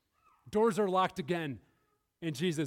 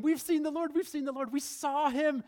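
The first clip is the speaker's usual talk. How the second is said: excited disciples; 9 semitones higher, 5 dB louder than usual. Acted. Disciples' excited report voiced.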